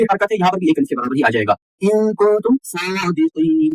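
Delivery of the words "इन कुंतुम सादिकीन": इन कुंतुम सादिकीन chanted slowly; slow tajweed chant of सादिकीन; qalqala recitation. Shown as a demonstration. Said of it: "कलकले की आवाज में".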